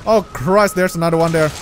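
ordinary talk

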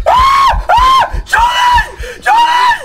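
high panicked screams for help; terrified screaming. Real, not acted. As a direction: scream names in pure terror.

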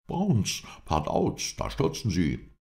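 high, excited Englishman calling out; excited cry. A narrator's reading. Englishman watches the horses fall, crying out excitedly. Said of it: "rief der Engländer".

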